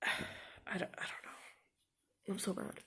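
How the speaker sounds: sighing, whispered helplessness; defeated whisper. Real, not acted. Student lost for words about her infection, whispering. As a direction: give up on the sentence in a whisper.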